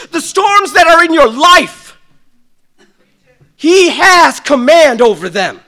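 loud, high preacher shout; preaching at full force. Real, not acted. Pastor preaching hard to the church, loud. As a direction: drive it home to the congregation.

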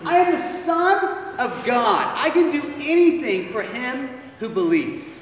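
high, loud offended retort; Jesus indignant at the father's doubt; indignant disbelief. Acted. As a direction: throw his words back at him. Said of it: "Jesus does not like that statement".

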